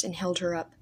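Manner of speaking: plain reading voice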